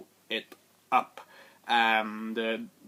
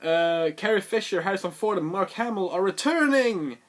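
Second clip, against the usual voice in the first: higher and louder hype voice; mock excited announcement; 7 semitones higher, 4 dB louder than usual. Studio announcing the cast return, hammed-up hype. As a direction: sell it like big news. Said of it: "they announced that".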